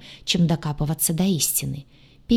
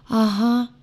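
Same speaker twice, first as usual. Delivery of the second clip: slightly higher, resigned assent; doomed agreement; 5 semitones higher than usual. A narrator's reading. Heroine agreeing with the doomed demon, resigned. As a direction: agree as if all is lost. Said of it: "не менее обречённо согласилась я".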